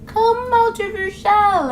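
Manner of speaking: high, slow, drawn-out mock coaxing; coaxing outsider. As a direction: stretch out the imitated lure, at full voice. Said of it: "they tend to be like".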